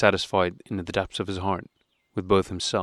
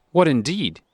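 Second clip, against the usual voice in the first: offended; 7 semitones higher, 4 dB louder, slower than usual. A narrator's reading. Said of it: "offended that he seemed opposed".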